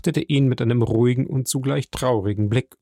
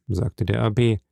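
usual speaking voice

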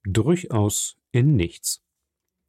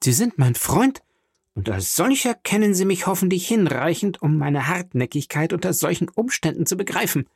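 higher-pitched, tense insistence; pressed lips; 7 semitones higher than usual. Acted. Angry viscount warns his journalist friend, tensely insistent. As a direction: press the point through tight lips. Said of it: "fuhr Albert mit gepressten Lippen fort".

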